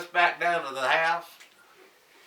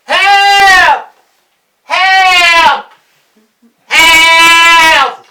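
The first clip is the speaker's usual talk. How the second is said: high, full-volume holler; hollering for help; 13 semitones higher, 17 dB louder, much slower than usual. Acted. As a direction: holler long and loud across the fields. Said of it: "we began to holler".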